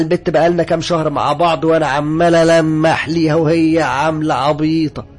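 loud, high indignant outburst; upset. Acted. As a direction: protest at full voice, stretching the line endings. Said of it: "لكن فضل زعل وقال لي". (زعيق واحد زعلان ومتغاظ)

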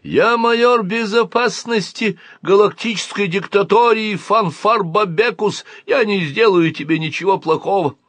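high-pitched, declaiming officer; alien major. A narrator's reading. Alien major introduces himself to the boy, high declamation. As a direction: announce your rank in a high voice.

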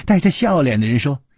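ordinary delivery, nothing marked

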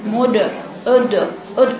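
slow مد، أد with rounded lips colouring the د; wrong damma-tinged qalqala. Shown as a demonstration. Teacher mimics a student's wrong reading. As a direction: imitate the mistake, the د shaded toward "u". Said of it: "خطأ".

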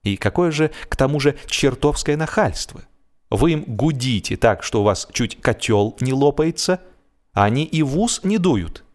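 ordinary delivery

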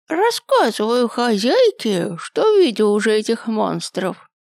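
high, creaky, slow ancient grandpa; very old man. A narrator's reading. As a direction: groan it out like a frail old man.

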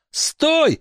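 put-on very high boy's cry; boy's shout. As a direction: cry out stop as a boy. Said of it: "крикнул Брэндон".

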